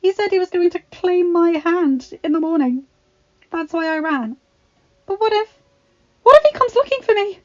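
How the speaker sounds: high, rising panicked voice; fresh fear. A narrator's reading. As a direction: let panic build to the end. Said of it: "she said in fresh fear".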